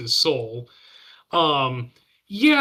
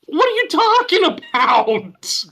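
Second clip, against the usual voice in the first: exasperated; 12 semitones higher, 5 dB louder, slower than usual. Real, not acted. Mock outrage at movie logic.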